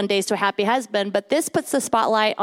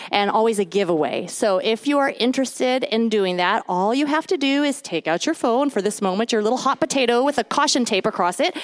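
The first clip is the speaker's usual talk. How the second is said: ordinary delivery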